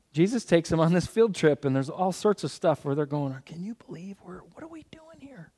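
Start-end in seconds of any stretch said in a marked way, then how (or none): 3.4-5.5 hushed, unvoiced whisper; a secret aside between wary friends. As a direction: whisper it like nervous disciples who shouldn't be here. Disciples whispering on unclean land.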